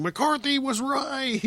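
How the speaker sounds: high-pitched strained mock holler, drawn out; slow, high, hammed-up cry of vindication; mock yelling. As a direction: yell it to the sky in mock outrage, without real volume. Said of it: "screaming, yelling, shaking my fist at the sky, yelling".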